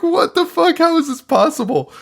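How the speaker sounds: stunned audience member squawking at the screen; baffled viewer. Retold or imitated. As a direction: throw your voice way up in shocked disbelief. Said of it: "you're like".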